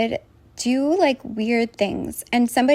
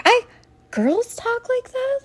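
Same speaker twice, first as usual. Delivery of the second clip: high, slow, stunned question; can't believe what she's reading; shocked disbelief; 13 semitones higher than usual. Real, not acted. Stunned by the printouts, asking in high disbelief.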